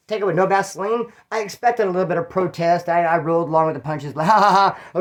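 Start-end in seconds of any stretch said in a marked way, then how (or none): 4.3-5.0 mock "ha, ha, ha" laughter; re-enacted laugh. Rolling with the punches, mock laughing. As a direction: laugh off a protest, put on.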